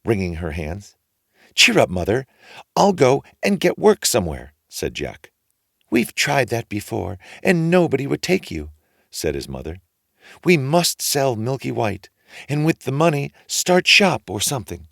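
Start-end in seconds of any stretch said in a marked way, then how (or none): 1.6-4.5 a hint of a cheerful boy, higher; eager lad reassuring his mother; lightly voiced young Jack. A narrator's reading. Jack's voice in story. 5.9-8.7 higher, a touch weary; a hint of a doubtful mother; lightly voiced mother. A narrator's reading. Mother's voice in story. 10.4-14.9 higher, a touch weary; a hint of a doubtful mother; lightly voiced mother. A narrator's reading. Mother's voice in story.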